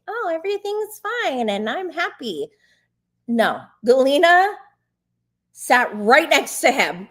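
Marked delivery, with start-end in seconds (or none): 0.1-2.5 high, sing-song pretend happiness; mock someone pretending all is well; exaggerated breezy fine-and-dandy voice; fake cheerful. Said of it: "be like".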